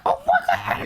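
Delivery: very high excited squeal; voice octave leap. Shown as a demonstration. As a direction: demonstrate the voice shooting up sky-high. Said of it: "our voice octave starts to just".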